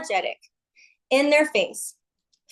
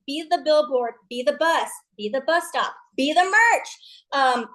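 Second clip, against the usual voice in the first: usual speaking voice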